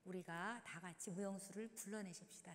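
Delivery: ordinary delivery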